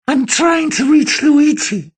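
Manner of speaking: high, slow, metallic vocoder voice; slurred, shrill and machine-like; distorted voice. Acted. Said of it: "slurred, metallic, abnormally shrill".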